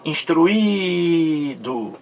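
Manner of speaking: instruído with a very long held í; stress on second i. Shown as a demonstration. Teacher pointing to esse segundo I, instruído with stretched í. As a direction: draw the í of instruído right out, slowly. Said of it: "Esse segundo I".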